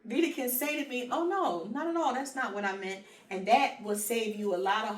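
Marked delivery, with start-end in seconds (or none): 1.1-3.0 quick friendly denial. Acted. Friend clearing up what she meant, high emphatic denial. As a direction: jump up high to clear up a misunderstanding. Voices Vita correcting a misunderstanding.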